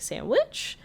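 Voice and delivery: high, chirpy servant offering; chimp servant offer. Retold or imitated. Chimp servant offering the mayor a sandwich, high chirpy voice. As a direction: perk up like an eager servant.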